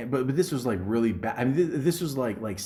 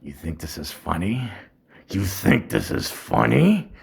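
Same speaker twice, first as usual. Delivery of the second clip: menacing teacher; 3 semitones lower, 5 dB louder than usual. Retold or imitated. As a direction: threaten the class, growing louder. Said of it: "And he's like".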